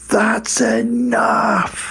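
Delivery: high, very drawn-out imitation of Santa calling; booming-Santa impression without full volume; distant Santa call. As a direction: stretch each word as if heard from far away. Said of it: "Santa from far away say".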